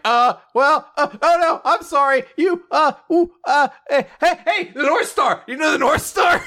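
high, flustered stammering building to a blurted question; mock-flustered, grasping for something to say; adorably awkward. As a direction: fumble awkwardly in a high voice, laughing through it. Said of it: "they're both adorably awkward".

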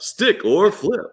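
high, very slow teasing host question; flirty teasing dating host. Acted. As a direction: stretch it out like a dating show host. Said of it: "contestant number one".

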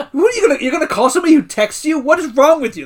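fast, high, loud mock outrage; imitating freaked-out Kelly. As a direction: rattle it off as if freaking out. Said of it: "freaks out".

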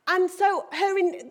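ordinary talk

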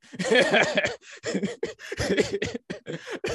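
high burst of laughter; loud laugh. Real, not acted. Laugh after retold story.

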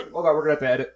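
rushed words through choking coughs; coughing while trying to talk; choking on coffee. Real, not acted. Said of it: "I just choked on my coffee".

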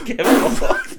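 high, laughing mock squeal. Real, not acted. Friends crack up at the scene, squealing laughter.

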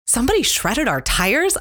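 high, rising shocked question; startled disbelief at the tires; retold disbelief. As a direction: replay the stunned first guess. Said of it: "And I go".

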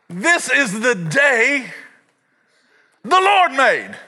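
high, insistent over-excited father; hammed-up high-pitched fervour; amped-up dad. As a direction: imitate an electrified dad preaching at his son. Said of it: "boy, all amped up".

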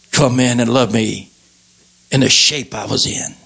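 low, hushed-pitch testimony with long pauses; tender heartfelt preaching. Real, not acted. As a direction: sink low and let each phrase settle.

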